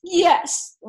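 put-on high little-girl yes; small child's yes. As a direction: answer like a worn-out toddler. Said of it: "And she said".

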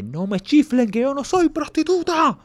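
high, indignant mock scolding; shrill high-pitched officer put-down; offended officer. Officer scolding the whistling detainees. (oficial ofendido con voz aguda)

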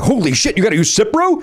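mock alarm. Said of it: "it was like".

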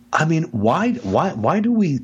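normal talking voice

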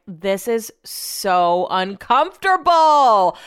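stretched, squirming complaint; drawn-out whine. Retold or imitated. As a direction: moan it like you're squirming. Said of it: "And I'm like".

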